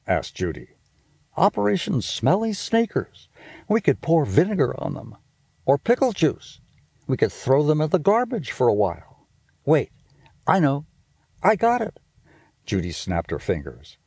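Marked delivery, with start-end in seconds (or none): 1.4-12.0 high, quick bossy big-sister voice; a know-it-all sister teasing her brother; Judy's voice. Acted. Big sister scolding little brother about smell, high and bossy. Judy speaking in story.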